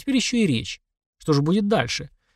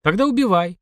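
higher, brusque challenge; barked; 6 semitones higher than usual. A narrator's reading. Narrator daring the mutant to kill, barked. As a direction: throw down the dare sharply. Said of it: "рявкнул я".

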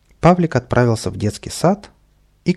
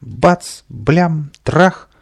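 rising, louder bangs; crash sounds; 6 semitones higher, 5 dB louder, much slower than usual. A narrator's reading. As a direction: punch each crash word. Lamps smashing.

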